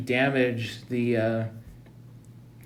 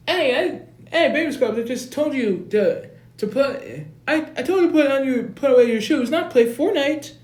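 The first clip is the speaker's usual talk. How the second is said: much higher, loud nagging voice; hammed-up bossy brother shouting; shrill big brother yell; 13 semitones higher, 6 dB louder than usual. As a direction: scold him for gaming, high and loud. Big brother yelling at gamer.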